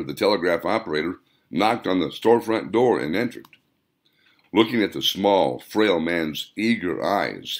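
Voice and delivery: ordinary delivery, nothing marked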